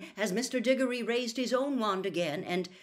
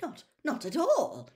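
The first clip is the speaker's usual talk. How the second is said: higher, stammering, flustered denial; horrified; 8 semitones higher than usual. A narrator's reading. Official placates his senior colleague, horrified and stammering. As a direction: backpedal anxiously. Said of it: "Amos Diggory looked horrified".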